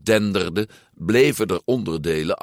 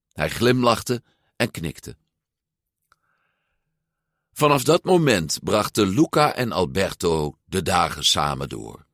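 ordinary delivery, nothing marked

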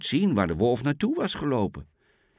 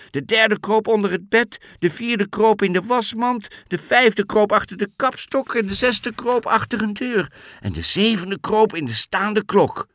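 higher, louder breathless telling; excited narration; 10 semitones higher, 6 dB louder than usual. A narrator's reading. Narrator telling the wolf's attack, excited and loud. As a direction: build the panic as the kids scatter. Wolf bursts in, kids scatter.

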